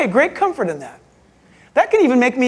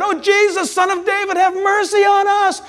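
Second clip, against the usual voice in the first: higher, pleading call to Jesus; pleading cry; 8 semitones higher than usual. Retold or imitated. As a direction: beg him for mercy. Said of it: "when they would cry out".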